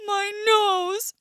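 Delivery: hurt bear. Acted. Bear just stung on the nose, pained wail. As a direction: cry out at the sting.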